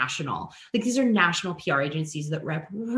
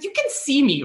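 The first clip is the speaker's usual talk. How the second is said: high, louder disbelieving question; incredulous; 8 semitones higher, 5 dB louder than usual. Retold or imitated. Ignored friend asking the influencer, high disbelief. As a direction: act stunned at being ignored.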